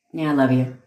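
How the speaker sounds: dismissive "love you" with no tenderness; offhand, unfeeling. Shown as a demonstration. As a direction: toss it off as if you don't mean it. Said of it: "That has a whole different meaning".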